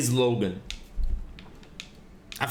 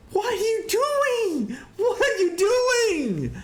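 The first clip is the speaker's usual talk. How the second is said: slow, much higher, laughing mock panic; mock panicked victim; slower than usual. Mocking a victim facing a killer, laughing mock panic. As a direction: whimper it like a helpless horror victim. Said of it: "And you're like".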